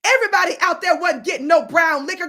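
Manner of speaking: normal talking voice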